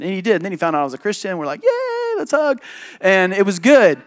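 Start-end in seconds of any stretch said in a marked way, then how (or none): 1.6-2.6 mock delight. Retold joyful reaction, exaggerated.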